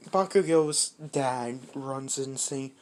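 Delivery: ordinary delivery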